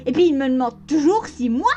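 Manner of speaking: plain reading voice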